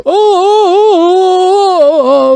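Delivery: sharp gasp, then a very high, loud cry of "Oh!"; mock the jungle man's wild yell; mock Tarzan yell. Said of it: "he screams like a fucking dumbass".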